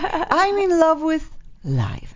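high, slow mock-serene voice; imitated calm. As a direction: imitate a blissfully calm guest, drawing it out. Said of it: "She just always makes me calm".

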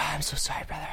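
whispered, soft sorry; whispered apology. Real, not acted. Apologizing after interruption.

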